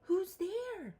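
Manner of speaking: high startled mouse cry. Acted. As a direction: call out like a tiny scared mouse. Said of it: "Mouse cries".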